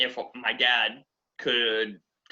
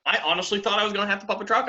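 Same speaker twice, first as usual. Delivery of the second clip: higher, rushed emphatic repeat; rising alarm; 6 semitones higher, much faster than usual. Real, not acted. New driver confessing his dread, rising and rushed. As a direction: climb in pitch as the dread builds.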